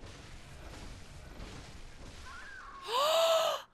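drawn-out gasp rising high; long gasp. Real, not acted. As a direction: gasp before a triumphant cry. Reaches success in the game.

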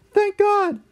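put-on squeaky old man relief; high cartoon dad, relieved.

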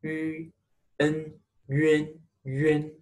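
yun built from yu and en; pinyin yun demo. Shown as a demonstration. Teacher shows students how yun is said. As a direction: blend yu into en: yun. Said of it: "It pronounced yun".

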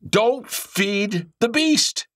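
Don't feed the beast drawn out very slow and high; slow emphatic warning. Real, not acted. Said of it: "listen to me".

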